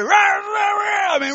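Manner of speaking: high, growling burst of babble; imitated tongues. Woman praying hard in tongues, loud imitated babble. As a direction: mimic someone going hard in tongues. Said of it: "really going hard in tongues".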